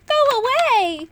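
high, loud squeak; squeaky little dragon voice. A narrator's reading. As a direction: squeak it out like a tiny frightened dragon. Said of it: "it squeaked".

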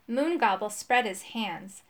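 usual speaking voice

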